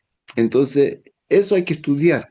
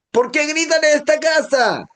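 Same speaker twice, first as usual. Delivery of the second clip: much higher, strained yell; mock shout; 13 semitones higher than usual. Father in the house yelling at family, mock shout. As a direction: yell the complaint about yelling. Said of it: "Y él está gritando".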